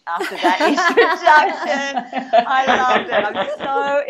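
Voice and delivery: speaking through real giggles, delighted; laughing while talking. Real, not acted. Guest thanking the hosts for her introduction, laughing. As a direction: let the laugh spill into the words.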